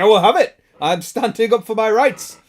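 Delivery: loud, high protest; mock indignation. Acting out a viewer standing up for their rights, mock-indignant. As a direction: declare it like a defiant caller.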